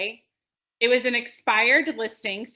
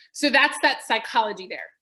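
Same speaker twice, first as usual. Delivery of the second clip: ordinary talk, nothing marked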